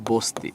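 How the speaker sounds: "বস্তি" said as bosti, with a closed o; ব হয় বো. Shown as a demonstration. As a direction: round the first vowel of "বস্তি" to bo. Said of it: "বোকার বো হয়ে যাবে". ("বস্তি"-র ব-কে বো করে বোস্তি বলা)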